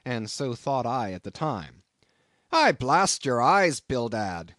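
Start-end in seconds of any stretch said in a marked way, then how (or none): 2.5-4.5 loud, slow angry curse; exasperated captain's shout; crying out. A narrator's reading. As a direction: burst out at an old partner. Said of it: "cried Peleg".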